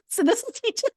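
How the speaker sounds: high, breaking into a laugh; words lost in giggles; laughing squeak. Real, not acted. Wife describing husband, laughing high.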